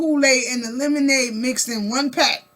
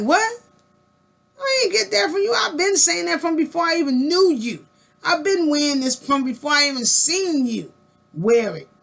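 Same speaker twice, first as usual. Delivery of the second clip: higher, indignant protest; hammed-up denial to a friend; mock mad denial; 4 semitones higher than usual. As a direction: act offended, pitch jumping up. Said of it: "You might get mad".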